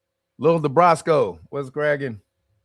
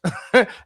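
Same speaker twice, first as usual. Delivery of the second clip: short laugh; 6 semitones higher, 4 dB louder than usual. Real, not acted. Laughs at own remark.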